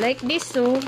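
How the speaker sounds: ordinary talk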